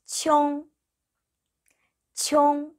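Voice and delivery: 총 as a gliding 치옹, high and level; teacher's sustained high 총; model pronunciation. Shown as a demonstration. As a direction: hold 총 long with a y-glide after the ch. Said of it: "이럴 때는".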